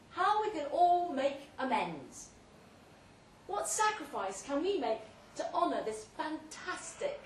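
rally the guests like a preacher; high-flown appeal through a loud hailer; impassioned loud speech. Acted.

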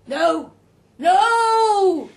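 high, very loud shouted refusal; loud, insistent no; emphatic refusal. A narrator's reading. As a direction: shout it, the second one bigger and longer. Roger refuses the boy.

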